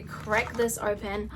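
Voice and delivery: ordinary delivery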